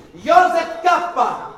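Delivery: long, loud, high shout of a name; urlo del nome. Retold or imitated. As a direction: bellow the name, drawn out slow. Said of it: "dovrò urlare, ma è solo il mio nome che lui urla, così". (inspector calling K, loud slow shout)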